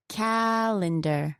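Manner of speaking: CAL-endar, slow, long stressed first syllable. Shown as a demonstration. Teacher answering where the stress falls, first-syllable stress. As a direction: draw out the first syllable of calendar. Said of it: "It's on the first one again".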